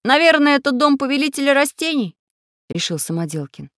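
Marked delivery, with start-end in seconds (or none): 0.0-2.2 high, loud exclamation; excited guess. A narrator's reading. Самоделкин guessing whose house it is, excited. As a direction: announce the discovery, pitched up. Rescuers discover the villain's house.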